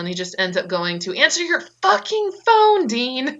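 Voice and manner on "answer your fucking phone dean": high, loud, slow furious yell; angry yell. Retold or imitated. Scolding Dean for never answering his phone, furious yell. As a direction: bellow it like you've been ignored all day.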